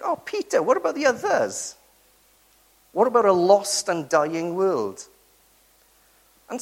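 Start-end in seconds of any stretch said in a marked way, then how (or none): 0.0-5.1 exasperated rebuke. Retold or imitated. Rebuking Peter's selfishness.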